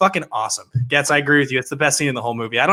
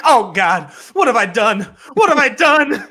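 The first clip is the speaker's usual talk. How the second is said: hammed-up cries, higher and louder; mock bad acting; 8 semitones higher, 4 dB louder than usual. A host mocking Perrineau's overacting, hammed-up wail. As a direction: overplay the panic like a bad actor. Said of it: "how bad the acting is".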